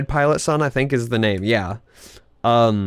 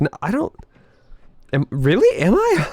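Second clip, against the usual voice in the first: squeaky mock disbelief; 6 semitones higher than usual. Imagined reply to an insulting song.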